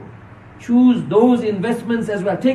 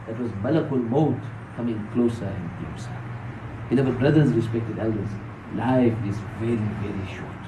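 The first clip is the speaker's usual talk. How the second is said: low, hushed preaching, sinking softer; solemn reminder; 9 semitones lower, 6 dB quieter than usual. Real, not acted. Preacher reminding his congregation that life is short, solemn and hushed. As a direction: let the voice drop low and soft.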